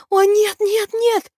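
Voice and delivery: very high, wailing protest; panicked cry. A narrator's reading. Maeve terrified of the growing banshee, high panicked cry. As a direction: let panic push the voice right up.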